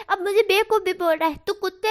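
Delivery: outraged yell at a mocking friend; furious shout. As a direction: explode at the insult. Angry reply to insult.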